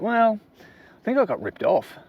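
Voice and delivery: a mildly rueful voice; disappointed. Pretend. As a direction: sound deflated after being ripped off. Buyer telling friend about bad purchase.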